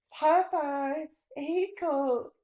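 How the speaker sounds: ordinary delivery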